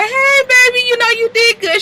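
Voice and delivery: high, sugary mock girlfriend; sweet girlfriend voice. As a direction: coo it up high to claim him. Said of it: "Brooke asserts herself".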